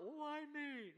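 high, drawn-out mock wail; hammed-up high-pitched lament; woeful self-pity. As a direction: plead it like a put-upon sufferer. Woeful question he rejects.

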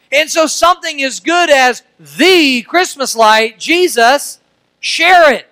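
high, loud, slow preaching; preaching at full force. Acted. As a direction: drive it home to the congregation, high and loud.

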